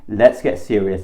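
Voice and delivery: usual speaking voice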